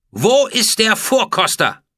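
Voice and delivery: loud, high, slow imperious call; clear, cutting demand. A narrator's reading. Furious pharaoh demands the food taster, cutting shout. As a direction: let it cut through the hall. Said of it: "durchschnitt ihre klare Stimme den Silbersaal".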